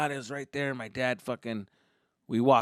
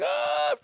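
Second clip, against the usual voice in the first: short high-pitched panicked yell; freaking-out yell; 4 dB louder than usual. Retold or imitated. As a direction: cry out as if freaking out. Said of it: "freaking out".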